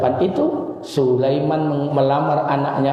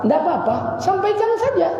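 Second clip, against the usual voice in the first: light mother voice. Acted. Zubaidah answers Sulaiman.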